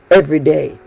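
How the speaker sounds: ordinary talk, nothing marked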